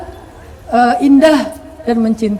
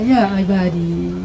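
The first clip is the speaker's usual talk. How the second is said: gentle call; 6 semitones lower, 4 dB quieter, much slower than usual. Acted.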